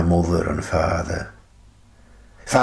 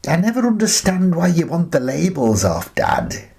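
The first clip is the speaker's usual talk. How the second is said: higher, quick exasperated daughter; daughter's voice; 10 semitones higher than usual. Acted. Old man voicing daughter Shannon asking why. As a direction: play his daughter, puzzled and teasing. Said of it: "she says".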